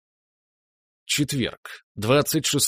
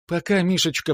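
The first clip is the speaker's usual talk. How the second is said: mother's fond goodbye; 7 semitones higher than usual. Acted. Mother's farewell, voiced high.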